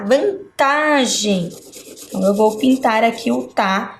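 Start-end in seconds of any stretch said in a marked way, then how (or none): 0.0-1.5 "vantagem" slowly broken up, leaning on "ta"; stress on TA. Shown as a demonstration. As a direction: split "vantagem" and stretch its "ta". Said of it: "A sílaba tá". ("vantagem" silabado, destacando o "ta")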